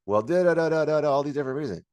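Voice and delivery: high, loud mock protest on babble syllables; mocking excuses. Mimics people's angry excuses.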